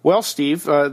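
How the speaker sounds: normal talking voice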